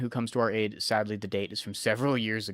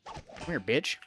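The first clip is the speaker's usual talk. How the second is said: taunting whisper; 6 dB quieter, slower than usual. Acted. Taunting enemy in fight.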